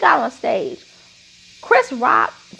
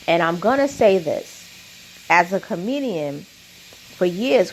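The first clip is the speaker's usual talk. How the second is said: ordinary talk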